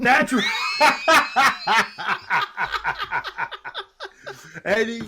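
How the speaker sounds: loud high laughing fit. Real, not acted. Cracking up at the plastic bag line, laughing loudly. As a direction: burst out laughing.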